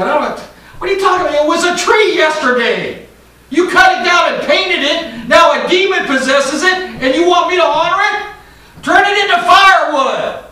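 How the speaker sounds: preaching at full force. Acted. Preacher defending a prophet's mockery, full-force shouting. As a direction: thunder it at the congregation.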